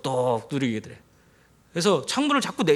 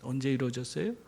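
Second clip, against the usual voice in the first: hushed question; 5 semitones lower, 8 dB quieter, faster than usual. Real, not acted. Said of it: "repeated question".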